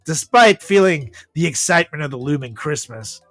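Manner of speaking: normal talking voice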